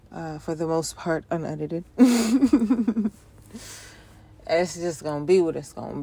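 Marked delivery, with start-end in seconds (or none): 1.9-3.1 bursts out laughing; a big real laugh; laughing out loud at himself. Real, not acted. Laughs at own intro.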